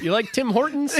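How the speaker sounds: hammed-up Canadian accent; bad Canadian accent. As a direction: tease like a cheesy Canadian host. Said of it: "these accents are terrible".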